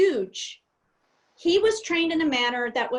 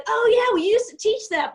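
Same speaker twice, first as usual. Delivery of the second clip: other pilots; 9 semitones higher, 6 dB louder than usual. Retold or imitated. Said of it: "other people said".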